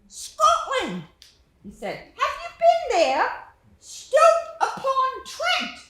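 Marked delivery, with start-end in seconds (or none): 0.1-1.0 put-on high, slow, loud British-accented boy; a small British boy asking politely, slow and high; British boy's voice. Little British boy asking the narrator about the game, high British accent. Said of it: "He had an accent. It sounded British". 2.0-5.8 put-on high, slow, loud British-accented boy; a small British boy asking politely, slow and high; British boy's voice. Little British boy asking the narrator about the game, high British accent. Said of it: "He had an accent. It sounded British".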